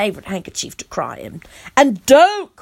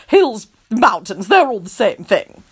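haughty gentleman voice. Acted. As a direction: wave it away grandly. Tony dismissive.

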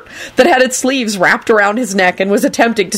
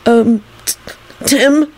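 hesitant, higher-pitched warning; 5 semitones higher, slower than usual. A narrator's reading. Melvin cautioning Tim at the bedside, warning. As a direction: stammer out a caution. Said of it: "Melvin said warningly".

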